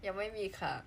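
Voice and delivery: ordinary delivery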